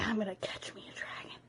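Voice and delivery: brooding whispered vow, fast. Acted. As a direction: whisper it to yourself, scheming. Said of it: "and I'm like".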